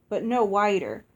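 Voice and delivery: ordinary delivery, nothing marked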